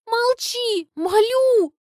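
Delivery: high, loud begging; hammed-up desperate plea; pleading. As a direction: implore him theatrically. Begging Kolya to stop.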